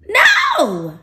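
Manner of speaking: loud, high, drawn-out no; shouted refusal. Acted. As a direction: cut off the tempter with a shout. Rejecting the devil's temptation.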